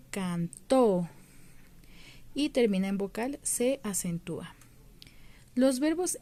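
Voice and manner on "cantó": cantó with a strong, drawn-out final -TÓ; can-TÓ, last syllable held and stressed. Shown as a demonstration. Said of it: "el acento se escucha al final".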